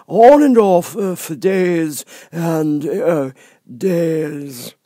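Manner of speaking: high, slow, lofty footman voice; frog footman. Acted. Frog footman dismissing Alice at the door, lofty. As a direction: talk down to her from on high. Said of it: "repeating his remark with variations".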